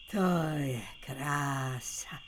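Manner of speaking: slow hushed whisper of delight. Acted. Resting alone in the quiet forest, soft whisper. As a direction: breathe it out, savouring the silence.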